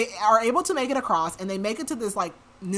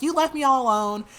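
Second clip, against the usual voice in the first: higher, louder mock-accusing complaint; retold snapping; 6 semitones higher, 5 dB louder than usual. Woman to the man who left her, snappy. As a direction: lightly act out snapping at him. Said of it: "she starts snapping at him".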